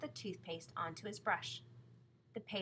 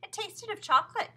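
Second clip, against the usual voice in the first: put-on much higher, louder boy's voice; eager explaining; 12 semitones higher, 11 dB louder than usual. A narrator's reading. Son explaining to Mother why he drank it, eager. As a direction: pipe up eagerly with the reason. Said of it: "John explained".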